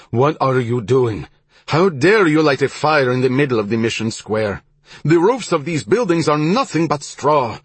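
loud, high, alarmed shout. A narrator's reading. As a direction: yell at the soldiers to stop. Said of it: "waving his arms and shouting".

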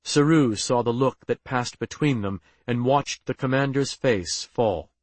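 ordinary delivery

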